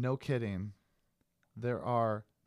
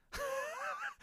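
squeaky disbelieving laugh; 9 dB quieter than usual. Real, not acted.

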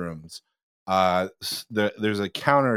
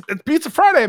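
high, loud burst of excitement; excited dad; 6 dB louder than usual. Retold or imitated. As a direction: imitate an excited dad, pitched way up. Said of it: "'cause he's excited".

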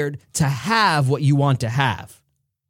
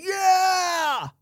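much higher, drawn-out yeah; high cheering yell. Acted. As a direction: cheer as an eager crowd. Everybody raising hands.

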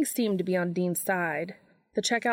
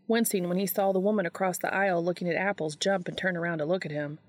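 usual speaking voice

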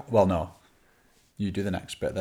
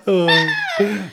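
big laughing fit; 13 semitones higher, 11 dB louder than usual. Real, not acted. Laughing at own bad accent.

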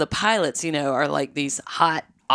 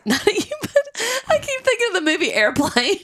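bursting into giggles; laughing. Real, not acted. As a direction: laugh while trying to hold it in. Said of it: "trying not to laugh".

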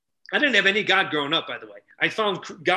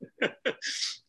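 airy laugh; 7 semitones higher, 7 dB quieter than usual. Real, not acted. Laugh after joke.

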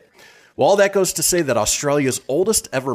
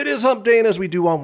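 much higher, louder show-host hype; excited announcement; 11 semitones higher, 4 dB louder, faster than usual. Pretend. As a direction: sell the day with a little hype. Hyped segment announcement.

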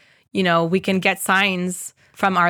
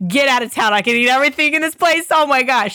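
higher and louder, rushing with delight; thrilled friend; 5 semitones higher, 4 dB louder, faster than usual. Retold or imitated. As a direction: retell her joy as if you can't believe your luck. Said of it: "She was in heaven".

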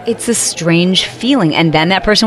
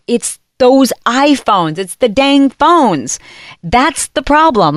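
slow, emphatic, slightly higher; slow realization; 6 semitones higher, much slower than usual. Retold or imitated. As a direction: spell out the culprit word by word. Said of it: "I finally figured out".

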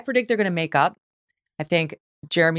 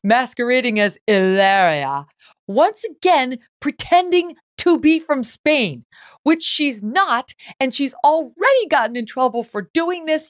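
mocking; 8 semitones higher, 4 dB louder, slower than usual. Real, not acted. Mocking Hilaria's fake Spanish persona.

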